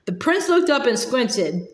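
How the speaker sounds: ordinary delivery, nothing marked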